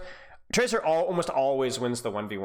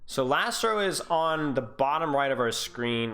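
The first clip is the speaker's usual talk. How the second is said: ordinary delivery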